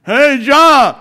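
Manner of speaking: imitated neighbor's loud holler; comic shouted greeting; Louie. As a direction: call out high and very loud, dragging the name. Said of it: "just the voice would make you just roar".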